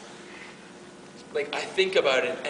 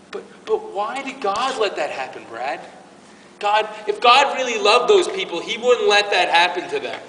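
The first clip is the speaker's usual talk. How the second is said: high, louder pleading voice of a doubter; questioning parishioner; 5 semitones higher, 7 dB louder than usual. Retold or imitated. Parishioner challenging the preacher about God, in a raised pleading voice. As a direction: push back like someone demanding answers. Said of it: "I get this question".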